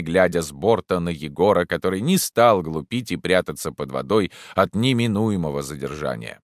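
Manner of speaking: ordinary delivery, nothing marked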